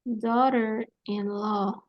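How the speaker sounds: Daughter-in-law drawn out slowly as doh-der-in-law; slow pronunciation model. Shown as a demonstration. Teacher dictates how Daughter-in-law sounds, slowly. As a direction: stretch Daughter-in-law out, syllable by syllable. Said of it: "Y va a ser de esta manera".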